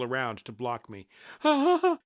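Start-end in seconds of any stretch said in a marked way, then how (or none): 1.4-2.0 high, loud gasping wail; keen in terror, out of breath; breathless keening cry. A narrator's reading. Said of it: "she keened, out of breath".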